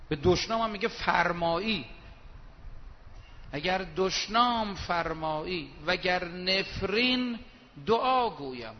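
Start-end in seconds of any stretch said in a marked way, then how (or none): 3.5-8.7 slow, drawn-out recited verse; gentle Persian verse recital; poem recital. Acted. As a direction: savour each word of the verse.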